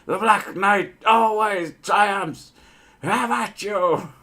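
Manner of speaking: high, drawn-out battle cry; hammed-up knight declaiming in triumph; mock-heroic knight. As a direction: stretch it out high, like a comic champion. Mock-heroic film quote as a knight.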